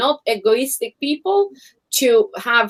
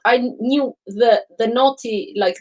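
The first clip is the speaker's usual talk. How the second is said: ordinary delivery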